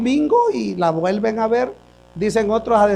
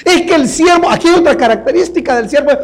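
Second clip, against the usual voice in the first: higher, louder sermon voice pressing the congregation; full-force preaching; 6 semitones higher, 3 dB louder than usual. Acted. Pastor urging the congregation to check their Bibles, preaching loud. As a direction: drive the question home.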